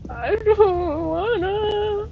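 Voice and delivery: slow, high, drawn-out whine; whiny refusal. Real, not acted. Refusing again on the stairs.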